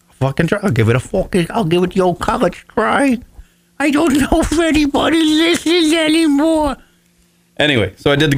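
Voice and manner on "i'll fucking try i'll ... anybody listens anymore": climbing into a high mock whine; mock whiny self. Mocking his own doubtful GoFundMe pitch, high whine. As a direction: ham up your own hopelessness, going higher. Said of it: "So, all right, I was like".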